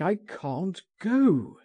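high, drawn-out plea; anguished cry. A narrator's reading. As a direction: plead helplessly, slow and high. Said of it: "he cried".

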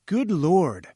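startled cry. A narrator's reading. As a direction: exclaim it high, taken aback. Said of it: "I cried".

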